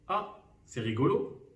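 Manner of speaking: ordinary delivery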